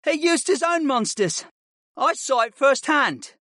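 high voice, vouching for his teacher; eager pupil as eyewitness; earnest boy student. Acted.

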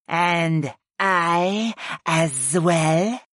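very slow, drawn-out character voice; goddess chiming in. A narrator's reading. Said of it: "Utuni chimed in".

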